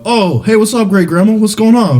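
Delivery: high, sheepish mock-cheery hello; overly casual greeting. Caught shotgunning by great-grandma, overly casual high greeting. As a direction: act innocent in a high-pitched voice.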